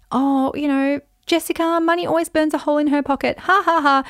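high-pitched, hammed-up family teasing; teasing relative. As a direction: tease the family baby, with a fake laugh.

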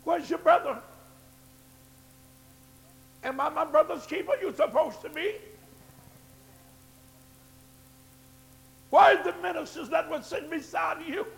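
preaching at full force. Acted. Pastor demanding the church find missing members, in a climbing preaching shout. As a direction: drive each question home to the pews.

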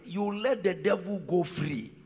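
ordinary talk, nothing marked

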